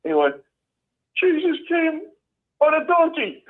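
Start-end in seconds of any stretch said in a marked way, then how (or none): 1.2-3.4 put-on high, frightened boy's voice; mock-terrified young boy, much higher. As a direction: squeak it out like a scared kid caught mid-reading. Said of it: "kinda terrified".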